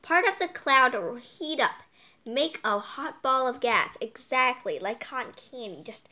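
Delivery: ordinary delivery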